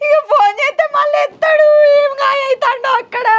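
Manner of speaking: high, frantic tearful shout; anxious cry. Acted. Wife cries out, fearing for her husband. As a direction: cry out in worry.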